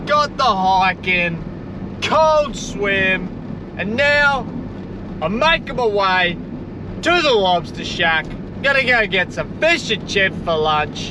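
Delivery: high, stretched-out joyful gushing; ecstatic outburst. Real, not acted. Vlogger gushing to viewers about his day, ecstatic high outburst. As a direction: burst with real joy over the perfect day. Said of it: "sorry for the outburst".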